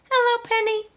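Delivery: high, bright little hen voice; Dora the hen. Acted. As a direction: play a chirpy young hen wondering aloud. She clucked.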